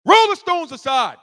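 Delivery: loud, high angry order; angry command. Acted. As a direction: shout it like a fed-up, mad Christ. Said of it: "That's how he said it".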